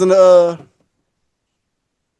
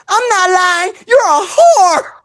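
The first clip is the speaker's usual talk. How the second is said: 1 yelling insult; 9 semitones higher, 3 dB louder, slower than usual. Retold or imitated. Girl yells back at Tim.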